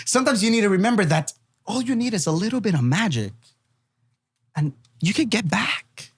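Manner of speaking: high, airy showman voice; magic show patter. Acted. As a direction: lift it like a magician's mystical pitch. Performing inspirational magic patter.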